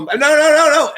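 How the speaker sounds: high, loud alarmed protest. Retold or imitated. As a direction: shout no, no in a panic. People behind bathroom door.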